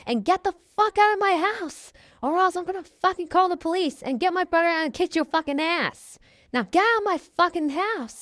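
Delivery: high-pitched, rapid-fire fury, acted out; angry breakup rant. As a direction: tell off a cheater, fast and high. Acted breakup quarrel with boyfriend.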